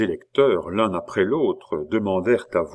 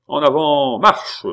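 loud, high military marching order; shouted command; 8 semitones higher, 8 dB louder than usual. A narrator's reading. Pitou orders his officers to set off, shouting. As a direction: cry it out to set troops marching. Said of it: "s'écria Pitou".

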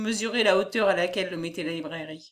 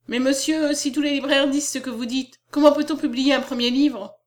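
higher, earnest objection; raised pitch, anxious-to-persuade question; protesting young poet; 6 semitones higher, 6 dB louder than usual. Acted. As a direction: plead the newcomer's case.

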